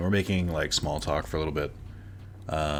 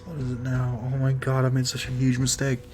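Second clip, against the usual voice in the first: retold private despair, hushed then higher; dismayed inner thought; 6 semitones higher than usual. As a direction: whisper in horror, then lament. Retold dismayed thought at date's complaint.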